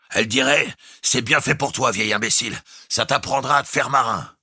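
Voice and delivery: a hint of a scolding wife, shouted high; bosun voicing his wife. A narrator's reading. The bosun imitates his wife scolding him, shouting.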